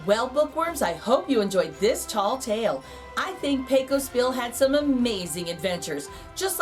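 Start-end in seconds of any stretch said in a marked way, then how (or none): none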